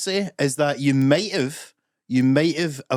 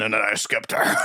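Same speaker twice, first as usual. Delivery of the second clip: gravel-free mock wrestling boss brag; lightly voiced mock wrestling boss; Vince McMahon voice; 3 semitones lower than usual. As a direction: deliver it like a wrestling promoter. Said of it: "Vince McMahon would have ended".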